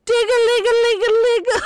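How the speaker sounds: laughing high bell imitation; bell sound effect. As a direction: ring it out like a bell. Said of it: "He doesn't like my sound effects".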